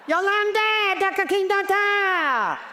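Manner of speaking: very high, hammed-up sing-song call; shrill-high playful repeat of the greeting. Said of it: "I'd go".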